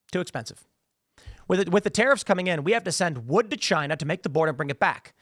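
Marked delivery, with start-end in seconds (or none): 0.1-0.7 flat repeat. Shown as a demonstration. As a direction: drop "Too expensive" down quietly.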